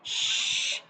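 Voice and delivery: sustained unvoiced sh; shushing sound. Shown as a demonstration. Teacher showing what sheen sounds like, long sh. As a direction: hush out the bare sh. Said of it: "it is the sound look like".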